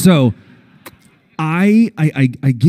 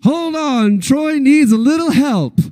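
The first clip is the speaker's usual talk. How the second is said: much higher, drawn-out ho ho ho call; calling wrangler; 12 semitones higher, slower than usual. Retold or imitated. Wrangler Reed stopping the group for Troy, high calling. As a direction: call the group to halt, big and calm.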